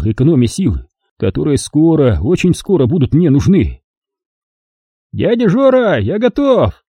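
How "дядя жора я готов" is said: high, happy call of readiness; eager shout. A narrator's reading. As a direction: call out gladly that you're ready. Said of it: "известил радостный Сеня".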